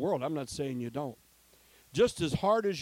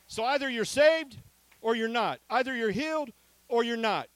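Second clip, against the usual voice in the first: loud, higher either-or call; preaching; 7 semitones higher, 6 dB louder than usual. Acted. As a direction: hammer the choice home.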